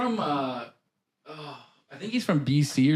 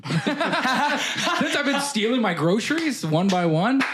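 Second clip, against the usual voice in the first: laughing; 7 semitones higher, much slower than usual. Real, not acted. Joking about shoplifting savings.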